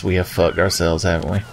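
normal talking voice

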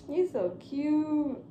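high, drawn-out adoring coo; real cooing. Real, not acted. Mother gushing over sleeping baby.

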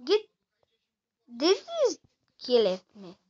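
ordinary delivery

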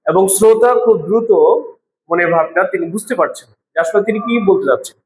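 usual speaking voice

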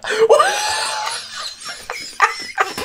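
high, breathless, helpless laughter; shrieking laugh. Real, not acted. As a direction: lose it completely, squealing. Hysterical laughing at game scene.

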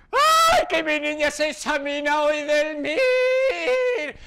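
lamento agudo y a gritos, muy lento, como una madre angustiada; madre dramática. Retold or imitated. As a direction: imita a la madre que llora y grita de nervios. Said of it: "te mira a los ojos fijamente y te dice". (madre gritando entre llantos, mucho más aguda)

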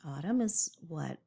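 ordinary talk

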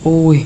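sudden loud ow of annoyance as the game stutters; loud frustrated ow. Real, not acted. Player groaning at the lagging game, loud ow. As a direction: blurt out an irritated groan.

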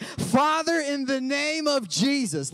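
high, loud preacher's prayer opening. Retold or imitated. Pastor praying aloud before thirty people, loud and high. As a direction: launch into prayer with full force. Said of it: "And I was like".

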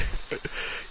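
silent wheezing laugh; breathy wheeze of laughter; breathless laugh. Real, not acted. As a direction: laugh without voice. Laughing at other player.

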